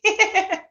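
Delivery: high bright burst of laughter; real laugh on seeing a friend; delighted laugh. Real, not acted. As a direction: laugh in happy surprise. Delighted to see Courtney.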